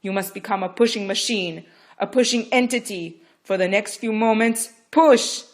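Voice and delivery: loud, high, driving orders; fierce commanding. Acted. Midwife commanding Rachel to push, fierce. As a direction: pound it into her.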